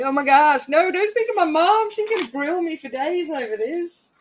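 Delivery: high-pitched mock alarm; retold panic. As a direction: reenact panicked protest, pitch jumping up. Retelling own panic at mother's call.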